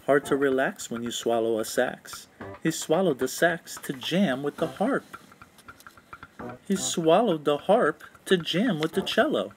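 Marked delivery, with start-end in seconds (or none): none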